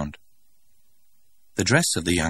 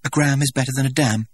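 higher, louder rote recital; mechanically; 8 semitones higher, 4 dB louder than usual. A narrator's reading. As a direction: recite it like a drilled slogan. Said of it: "said Lenina mechanically".

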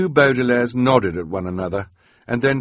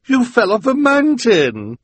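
much higher, louder villainess; shrill accusing shriek; shrieking Esme; 8 dB louder than usual. A narrator's reading. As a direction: cry it out aghast. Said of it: "she cried".

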